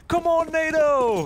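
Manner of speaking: loud, high, drawn-out fan yell; imitated yell. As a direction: yell it like a player rallying the team. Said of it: "they were sitting and yelling".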